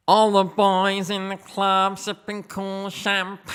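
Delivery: nasal, high, slow sung lyric; nasally voice. Pretend. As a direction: sing it through the nose, slowly. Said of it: "in his really nasally voice".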